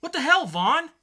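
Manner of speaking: hammed-up cop yelling at partner; acted outburst. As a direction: yell at your partner in alarm. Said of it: "dramatic reading".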